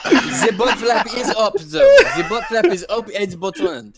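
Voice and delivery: giggly put-on French accent. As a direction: insist through laughter in a mock French accent. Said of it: "but in a French accent".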